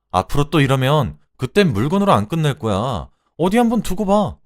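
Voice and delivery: loud, high-pitched furious rant; angry tirade. A narrator's reading. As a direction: tear into a husband. Wife rages back at her husband. (화가 나서 쏘아붙이며)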